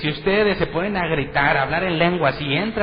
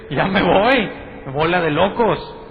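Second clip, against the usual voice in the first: loud, higher mock exasperation; visitor leaving; 4 semitones higher, 5 dB louder, slower than usual. A first-time visitor quits the noisy church, loud mock exasperation. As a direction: storm off, fed up with the crazies. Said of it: "¿O dices".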